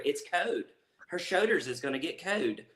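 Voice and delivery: Eastern Kentucky accent, "code" for cold. Shown as a demonstration. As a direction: drop the L's like Eastern Kentucky. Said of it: "really gives away the Eastern Kentucky".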